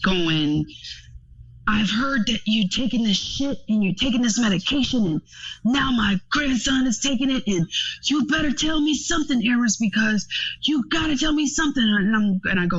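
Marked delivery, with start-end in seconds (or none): 1.7-11.8 higher, pleading upset father; dad almost in tears. Retold or imitated. Dad calling her almost in tears, pleading. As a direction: voice the tearful dad demanding answers. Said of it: "almost in tears, going".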